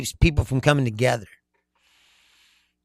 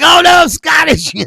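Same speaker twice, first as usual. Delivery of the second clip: high, loud, hammed-up cry; mock dismay; 12 semitones higher, 8 dB louder than usual. Man from the commercial learning his roots, mock horrified shout. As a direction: wail it as an overblown revelation.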